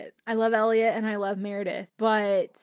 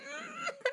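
teary laugh; 12 dB quieter than usual. Real, not acted. Tearful outburst over the book.

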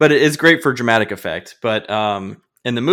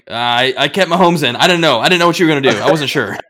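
mock panicked friend; 4 semitones higher, 7 dB louder than usual. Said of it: "you're gonna be like".

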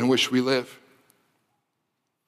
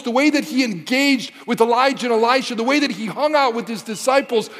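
rising, impassioned preacher voice, climbing higher; fervent preaching; 7 semitones higher than usual. Acted. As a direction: build it like a revival sermon to the peak. Preaching builds to climax.

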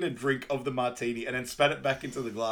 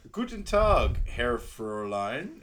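put-on German greeting in a broad American accent; 4 dB louder, much slower than usual. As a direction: do clumsy American German, stretching the words. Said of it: "really American, uh, German".

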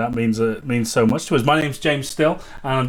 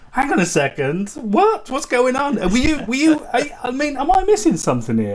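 higher, half-laughing retold bafflement; incredulous disbelief; 8 semitones higher than usual. Retelling finding his teacher missing from old posts, incredulous. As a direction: play the baffled fan scrolling old posts. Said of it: "I'm like".

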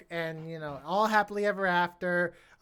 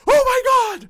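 a sudden, much higher, much louder shriek of shock; shocked gasp; 10 dB louder than usual. Retold or imitated. Bryce Dallas Howard reacting to the stranger's reveal, shrieking in shock. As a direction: blurt it out as if the twist just hit you. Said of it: "Bryce Dallas Howard just goes like".

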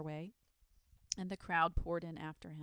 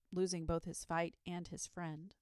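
plain reading voice

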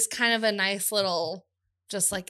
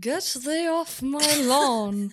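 slow, exaggerated bad British accent, pitched higher; fake British accent; 4 semitones higher, 3 dB louder, much slower than usual. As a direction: lean into a hammy Regency accent. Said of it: "lean into it a little bit more".

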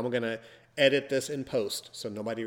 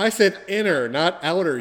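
loud protest; 6 semitones higher, 8 dB louder than usual. Real, not acted. Protesting loudly.

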